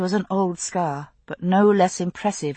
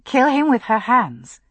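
ordinary delivery, nothing marked

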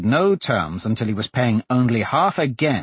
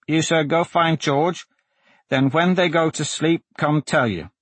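lightly voiced little boy, higher; small voice; 6 semitones higher than usual. A narrator's reading. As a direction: recite it back like a small boy. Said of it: "a small voice said".

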